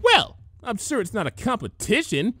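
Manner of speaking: high, haughty, nose in the air; stately disdain. A narrator's reading. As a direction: sniff it with lofty disdain. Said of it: "she said with stately disdain".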